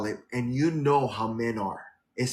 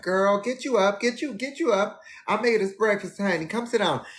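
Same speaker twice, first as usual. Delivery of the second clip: retold sing-song morning call, pitched up; high cheery wake-up call; 7 semitones higher than usual. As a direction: chirp him awake with breakfast news, mock bright. Voicing herself waking hungover friend.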